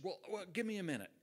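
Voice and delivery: imitating a flustered interviewer, quick and stumbling; flustered stammering. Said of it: "And I stammered around".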